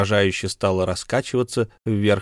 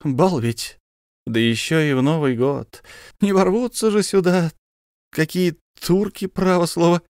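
lightly voiced joking officer; 8 semitones higher than usual. Officer jokes about turks.

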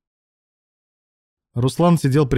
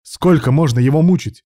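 loud, raised reproach; angry shout; 5 semitones higher, 7 dB louder than usual. A narrator's reading. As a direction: shout the question in exasperation. Angry quarrel, shouting back.

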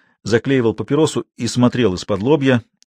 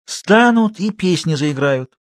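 higher-pitched rustic storyteller, in earnest; old watchman spinning yarn; 7 semitones higher, slower than usual. A narrator's reading. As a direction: describe the goblins with drawn-out, eerie words. Матвей продолжает рассказ.

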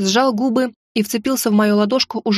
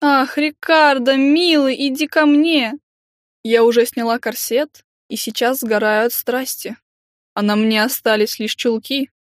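sultry breathy voice; 2 semitones higher than usual. Retold or imitated. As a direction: play a swooning mistress. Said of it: "с томным придыханием".

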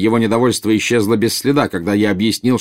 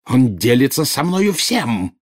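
higher, slow indignant retort; bristling; 5 semitones higher, slower than usual. A narrator's reading. Bellatrix insisting the Dark Lord trusts her, bristling. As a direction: stretch it out, stung and defensive. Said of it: "тут же ощетинилась Белатриса".

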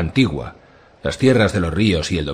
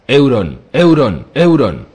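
loud, high repeated chant; spreading crowd shout; 6 semitones higher, 7 dB louder, slower than usual. A narrator's reading. Crowd taking up the cry for Euron, shouting. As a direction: hammer out the name like a crowd. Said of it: "El grito se extendió".

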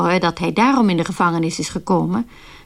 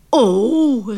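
dismayed cry. Retold or imitated. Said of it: "riep hij".

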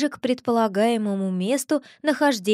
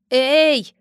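sharp, louder shout of "hey"; calling out; 3 semitones higher, 7 dB louder than usual. A narrator's reading. Familiar calls down into the floor, shouting. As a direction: call out to whoever is below. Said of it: "крикнул куда-то в пол Виля".

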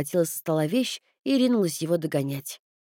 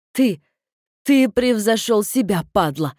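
loud raging insult; furious yelling; 2 semitones higher, 5 dB louder than usual. Acted. Sister yelling at her brother, furious. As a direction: hurl it at him in fury.

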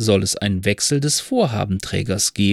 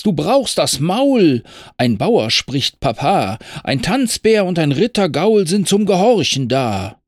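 lecturing rider; 7 semitones higher, 3 dB louder than usual. Acted. Max lecturing his horse on obedience, loud and lordly. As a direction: lay down the law to the horse. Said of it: "rief Max".

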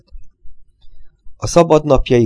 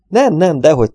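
high, louder offended denial; hurt protest; 7 semitones higher, 5 dB louder than usual. A narrator's reading. The little man protests to Gregory, high and hurt. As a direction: protest as if deeply insulted. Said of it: "tiltakozott őszintén megbántódva a kisember".

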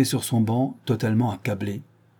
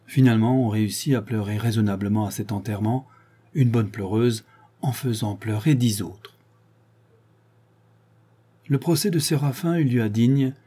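ordinary delivery, nothing marked

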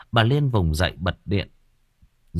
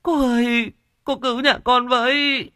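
put-on much higher, begging old woman voice; pleading wife; 14 semitones higher than usual. Kneeling wife begs the medium to save her family, high and pleading. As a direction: beg on your knees for rescue. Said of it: "Bà quỳ mọp xuống".

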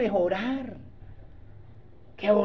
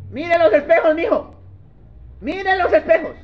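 higher, louder insistent granny voice; grandma's advice; 8 semitones higher, 4 dB louder than usual. Retold or imitated. Preacher voices a grandmother advising her grandson, higher insistent granny. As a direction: urge it like a caring grandmother. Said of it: "la abuela le dice a uno".

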